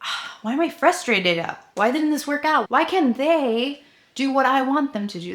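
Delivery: a hint of a hangry rant; mock frustrated whine. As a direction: spiral into why me, pleading. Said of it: "Instead of being like".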